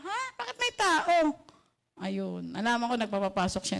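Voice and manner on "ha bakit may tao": retold surprise. Retold shock finding someone inside.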